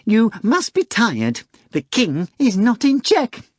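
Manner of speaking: high, slow mock worry from the cat; falsely anxious. A narrator's reading. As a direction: fake concern to stall for time. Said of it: "in a falsely anxious voice".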